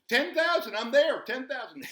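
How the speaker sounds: bursting proud at the finish line; triumphant shout. Acted. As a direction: crow it like you just won.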